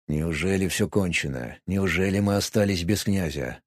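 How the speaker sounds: low, soft anxious thought; hushed inner thought. A narrator's reading. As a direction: think it in dread, quietly. Archmage's inner dread thought.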